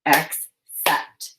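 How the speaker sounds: "Accept" split into two syllables with a long pause between; "Accept" broken as ac... cept; chopped syllables. Shown as a demonstration. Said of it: "Now chop the syllables in".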